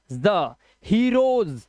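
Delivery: long buzzed ज, then हीरोज ending in z; slow demo of voiced z in हीरोज; Z with nukta. Shown as a demonstration. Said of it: "जा के नीचे नुक्ता".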